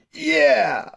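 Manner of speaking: put-on high excited baby-like yeah; squeaky little boy's triumphant yeah; baby's yeah. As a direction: show off like a proud baby flexing.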